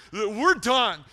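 fired-up sermon peak; full-force preaching. Real, not acted. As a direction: hammer it home loudly.

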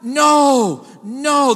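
NO, NO shouted loud and high, hammering each no; emphatic no. Shown as a demonstration. Preacher to congregation, stressing the no: shouted "no, no". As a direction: slam down every "no" like a verdict. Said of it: "There's a strong emphasis on the no".

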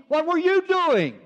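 high, loud punched question; incredulous demand. Acted. Officer finally confronting the monkey, insistent and high. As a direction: demand an answer, pitch shooting up.

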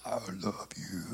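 very low, soft, growly "I love you"; scary deep voice. Retold or imitated. Worship leader teasing the baby girl, very low and spooky. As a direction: rumble it low enough to scare a baby. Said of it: "That scared me".

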